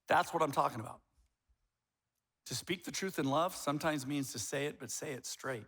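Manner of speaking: ordinary talk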